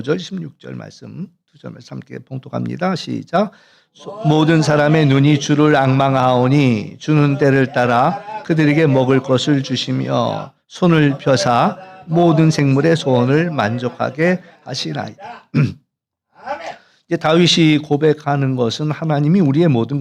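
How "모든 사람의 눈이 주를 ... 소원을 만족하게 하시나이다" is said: slow, loud, measured congregational recitation; formal Bible reading in even, drawn-out phrases; scripture reading aloud. Real, not acted. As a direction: lead the congregation through the verse, slowly.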